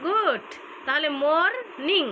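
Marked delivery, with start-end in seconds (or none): 1.1-2.0 "মর্নিং" slowly, as two parts, মর and নিং; split into syllables. Shown as a demonstration. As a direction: stretch "মর্নিং" out syllable by syllable, pitched up. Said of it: "তুমি ভাগ করে করবা এরকম".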